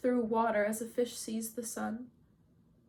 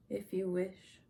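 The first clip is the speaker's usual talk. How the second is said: listless indifference; 2 semitones lower, 5 dB quieter, slower than usual. A narrator's reading. Grieving Achilles answering Agamemnon, quiet and indifferent. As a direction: answer quietly, past caring.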